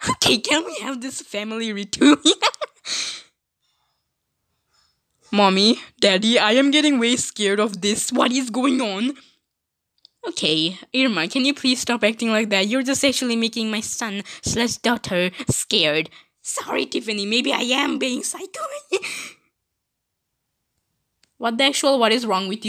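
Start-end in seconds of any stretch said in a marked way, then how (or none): 2.2-3.2 a quick high-pitched giggle. Acted. Tiffany giggling at the family reunion, high. 18.7-19.3 soft, very high unhinged giggle; a quiet high psycho titter; creepy giggle. Acted. Irma giggling after calling herself psycho, soft and high.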